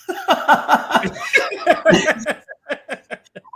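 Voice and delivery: high-pitched, drawn-out laughter; extended real laughing fit; long laugh. Real, not acted. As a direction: burst out laughing and keep it going. Reacting to squatters walking in.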